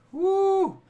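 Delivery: high, loud woo; whoop. Real, not acted. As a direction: let out a high, excited whoop. Whooping on a livestream.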